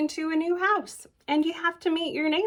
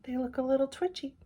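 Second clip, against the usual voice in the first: usual speaking voice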